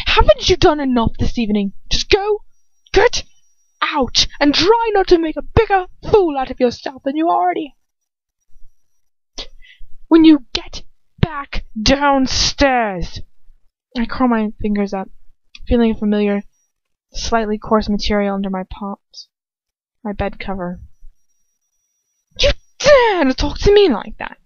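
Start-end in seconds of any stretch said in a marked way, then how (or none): 0.1-13.2 high, angry command; shrill order. Acted. Bellatrix furiously orders Lucius out, pitched high. 22.4-24.4 high, loud outrage; outraged. Acted. Lucius rounds on Bellatrix, loud and high with outrage.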